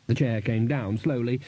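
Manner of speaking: usual speaking voice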